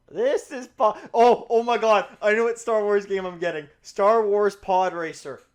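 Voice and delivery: loud, fast excited rush; thrilled outburst. Real, not acted. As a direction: blurt it out, overjoyed by the idea. Suddenly thrilled about a new game.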